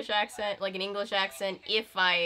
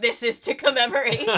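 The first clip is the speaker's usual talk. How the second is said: loud, higher self-quote; mock proclamation; 4 semitones higher, 8 dB louder than usual. Actor buying the stained book, loud mock declaration. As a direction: declare it loudly like a proud keepsake.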